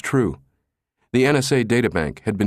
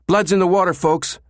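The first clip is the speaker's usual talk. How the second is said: alarmed call; 10 semitones higher, 4 dB louder than usual. A narrator's reading. Soshi warning of intruders.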